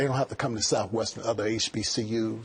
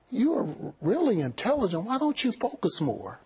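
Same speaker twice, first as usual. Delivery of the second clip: higher-pitched imitation of an encouraging professor; lightly voiced professor; 11 semitones higher than usual. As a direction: retell a teacher's earnest urging, pitched well up. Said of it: "She said".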